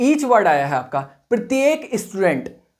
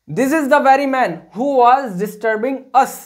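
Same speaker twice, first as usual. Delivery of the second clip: drawn-out word by word for copying; slow dictation; 4 semitones higher, 3 dB louder, much slower than usual. Shown as a demonstration. Teacher dictating an example sentence slowly to students. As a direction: spell it out slowly for the class. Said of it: "dictating example slowly".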